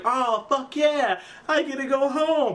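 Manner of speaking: high, drawn-out mock cheer; acted elation. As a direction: imitate a kid whooping at great news. Kid told he is going home.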